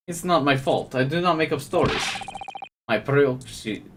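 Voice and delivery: put-on thick Russian accent, dropped articles; a sailor with a pet snake, in a heavy Russian accent. Explaining the escaped snake, in a Russian accent. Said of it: "I have such a natural Russian accent".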